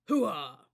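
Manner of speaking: loud throaty grunt; guttural hooah. A narrator's reading. Cadets answering the cadence, guttural shout. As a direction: bark it from the gut. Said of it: "loud guttural noise".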